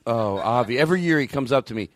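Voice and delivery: ordinary talk, nothing marked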